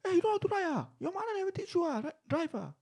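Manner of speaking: high mocking yell of the driver; angry driver. Driver yelling at the bikers, high mock yell.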